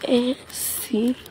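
ordinary talk

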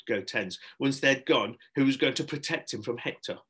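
ordinary delivery

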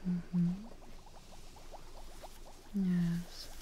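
ordinary delivery

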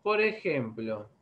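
normal talking voice

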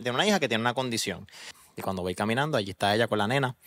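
ordinary talk, nothing marked

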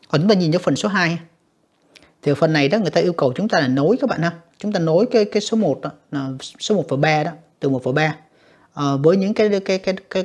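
ordinary delivery

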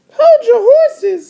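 very high, slow little-sister squeal. Acted. Little sister stopping her brother, high drawn-out voice. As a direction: stretch it out in a squeaky kid voice. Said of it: "said D W".

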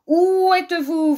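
high, loud, drawn-out wolf call; big bad wolf seeking prey; prowling wolf. A narrator's reading. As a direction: call out slowly like a hunting storybook wolf. Wolf hunts the hidden cats.

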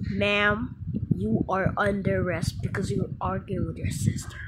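ordinary delivery

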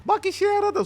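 put-on high whiny little-kid voice. Son's spirit calls to dad, high whiny child voice. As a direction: complain like a child left behind. Voicing the son.

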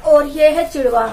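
ordinary delivery

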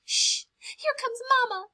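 high urgent hiss; baby bird Pip hissing that Mama is coming; hissed warning. Acted. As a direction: hush the others fast. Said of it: "hissed Pip".

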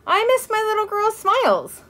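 high-pitched, wheedling affection; coaxing father. A narrator's reading. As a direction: coax a sulking little girl to cheer up. Father coaxes his sulking daughter.

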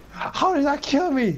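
high indignant shout; raging gamer. Real, not acted. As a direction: yell in disbelief. Complaining after death in game.

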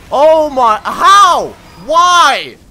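frustrated gamer yelling at the screen; exasperated outburst. Real, not acted. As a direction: fling the words at the game. Keeps dying, rages.

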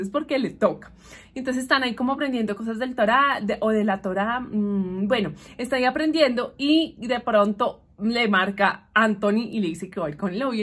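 ordinary delivery

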